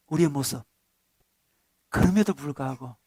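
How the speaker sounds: 그럼에도 불구하고 said low and soft; tender, low-voiced restatement; hushed. Shown as a demonstration. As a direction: drop the voice to a near hush on 그럼에도. Same phrase, now hushed.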